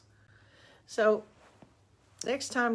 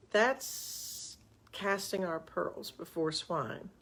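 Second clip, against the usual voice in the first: slow emphasis; 4 dB quieter, much slower than usual. Shown as a demonstration. Naming the saying.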